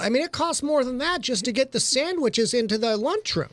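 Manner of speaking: lilting home-shopping presenter patter; hammed-up shopping channel pitch; QVC host. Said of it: "He wants to break into Q V C".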